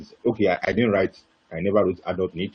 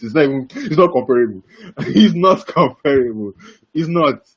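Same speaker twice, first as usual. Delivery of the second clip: high, loud, with a laugh breaking in; laughing emphasis; 6 semitones higher, 7 dB louder than usual. Real, not acted. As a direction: insist through amusement, louder and higher. Laughing at huge gap in pay.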